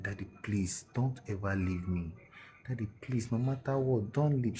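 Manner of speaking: soft plea that climbs in pitch; pleading little daughter. Retold or imitated. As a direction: gently imitate a small child begging her daddy. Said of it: "She's three years old".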